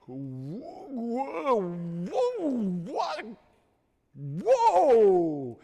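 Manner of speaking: high, loud stammered whoas; awestruck whoa. Retold or imitated. Stuttering student stunned as the campus beauty walks by. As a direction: gape at her, voice jumping up.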